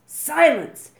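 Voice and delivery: huge, high, loud roar. A narrator's reading. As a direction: blast it to silence the boys. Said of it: "roared Gobber the Belch".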